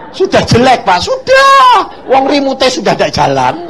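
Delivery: loud, high comic protest; acted-out exasperation; exasperated complaint. Exasperated retelling to police officer.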